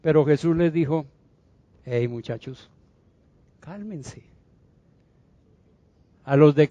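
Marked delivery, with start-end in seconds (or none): 1.8-4.2 low, hushed reassurance; soft, low soothing voice; calming. Acted. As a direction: settle frightened friends very softly. Jesus calming the frightened disciples.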